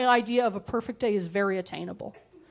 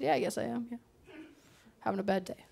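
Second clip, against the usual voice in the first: glum mumble; 12 dB quieter, faster than usual. Retold or imitated. Glum admission to Deb.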